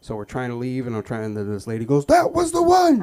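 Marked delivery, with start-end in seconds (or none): 2.1-3.0 high, loud accusing shriek; mock accusing shriek. Shop lady identifying the boy, shrill accusing shriek. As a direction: point and screech at the culprit.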